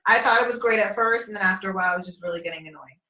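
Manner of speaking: ordinary delivery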